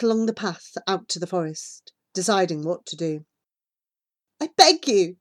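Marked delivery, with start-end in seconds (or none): 4.4-5.2 high, louder plea; begging. A narrator's reading. Child begging the hesitant boy for help, pleading. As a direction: beg him to give in. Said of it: "I plead with him".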